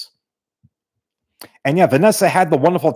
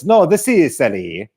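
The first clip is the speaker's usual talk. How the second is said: mock Italian accent, sing-song. Mocking Americans back from vacation in Italy, affected Italian accent. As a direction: put on a pretentious tourist's Italian accent. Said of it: "Italian accent about, you know, about Florence".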